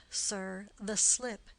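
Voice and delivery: normal talking voice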